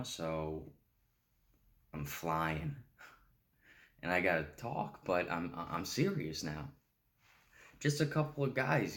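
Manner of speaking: put-on pathetic, childlike junkie rambling, stammering and restarting; needy junkie monologue, pitch lurching up as the words stumble; Fick. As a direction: plead like a strung-out kid, with halting pauses and nervous laughs. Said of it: "Fick is described as a pathetic, childlike junkie".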